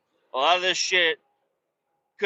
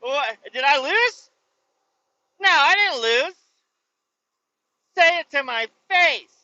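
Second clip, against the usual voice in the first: high, loud put-on indignant voice; a defeated politician refusing to accept it; sore loser; 10 semitones higher, 5 dB louder than usual. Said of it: "the other one's like".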